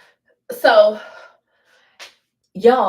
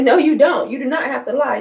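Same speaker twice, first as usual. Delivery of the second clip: louder, higher insisting; insisting loudly; 4 semitones higher, 4 dB louder than usual. Retold or imitated. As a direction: push back hard. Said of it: "I was like".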